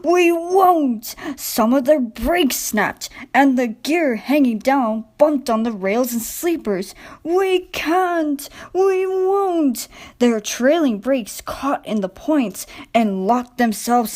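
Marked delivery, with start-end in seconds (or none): 0.0-1.2 high, stubborn wail; groaning trucks. A narrator's reading. Stubborn trucks refusing Diesel, high stubborn wail. 7.2-9.9 high, stubborn wail; groaning trucks. A narrator's reading. Stubborn trucks refusing Diesel, high stubborn wail.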